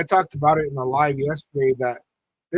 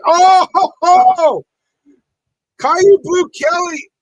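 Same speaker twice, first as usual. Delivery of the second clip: excited shout; 11 dB louder, slower than usual. Real, not acted. Big play happens on screen.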